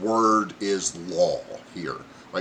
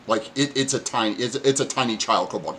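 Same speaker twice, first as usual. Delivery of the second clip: ordinary delivery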